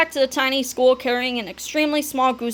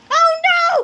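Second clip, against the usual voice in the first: high, loud alarm; cartoon kid crying out in fright; panicked cry; 6 dB louder than usual. A narrator's reading. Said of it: "cried Fluffy".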